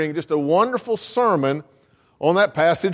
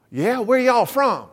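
retold line in a broad Southern US accent, higher; 5 semitones higher, slower than usual. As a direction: drop into a Georgia Southern drawl. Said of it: "what happened to the accent?".

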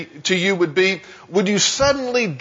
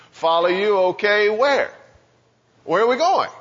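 higher, puzzled questions of a wary fisherman; doubtful disciple; 3 semitones higher than usual. Acted. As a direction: ask like a fisherman unsure about following. Voices doubtful disciple questioning Jesus.

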